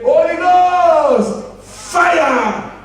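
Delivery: sung-out Holy Ghost fire; prolonged fervent cry. Retold or imitated. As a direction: ring it out to the rafters. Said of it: "When I say".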